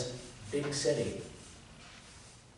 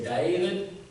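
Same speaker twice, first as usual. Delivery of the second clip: David drawn out Southern, starting a touch higher; mother's Southern drawl; 4 semitones higher than usual. Retold or imitated. Preacher imitating his mother calling him David. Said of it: "My mother would say".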